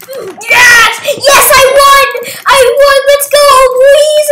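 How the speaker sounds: very high, very loud celebration; all-out, elated cheering; victory screaming. Real, not acted. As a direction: scream with joy at winning. Celebrates winning the round.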